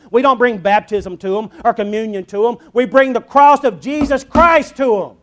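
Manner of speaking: loud, high, ringing sermon voice; full-force preaching. Acted. Preacher proclaiming the cross to his congregation, full-force preaching.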